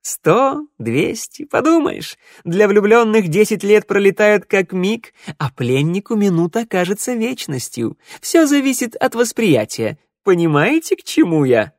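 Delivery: high, sly minstrel. Acted. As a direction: play the crafty old musician. Minstrel character voice.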